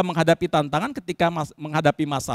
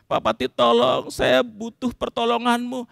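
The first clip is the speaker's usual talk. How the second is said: higher-pitched, unforced pleading; polite, humble plea; 4 semitones higher, slower than usual. Acted. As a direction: beg respectfully for help. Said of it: "Mestinya sopan, rendah hati". (memohon sopan, rendah hati)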